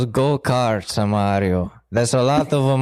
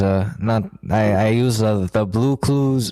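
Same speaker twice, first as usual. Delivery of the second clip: normal talking voice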